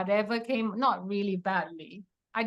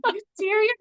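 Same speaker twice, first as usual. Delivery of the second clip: much higher and louder, disbelieving cry; a tearful shriek of disbelief; incredulous joy; 12 semitones higher, 6 dB louder than usual. Retold or imitated. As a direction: blurt it out, stunned with happy disbelief. Asking the doctor again, overjoyed.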